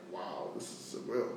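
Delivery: soft whisper of disbelief; hushed retold wonder at saying yes; awed whisper. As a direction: breathe it out, stunned. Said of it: "I was like".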